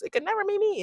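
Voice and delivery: very high-pitched, mimicked dismissal; mock boaster. Quoting someone who says it could never happen, high mocking imitation. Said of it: "every time someone says".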